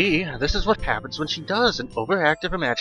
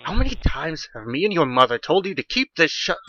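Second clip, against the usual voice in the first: higher-pitched, angry parental scolding; father scolding; 7 semitones higher than usual. A narrator's reading. Father to daughter about the open window, scolding. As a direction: scold the child in exasperation. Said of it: "angry at his scolding Glenda".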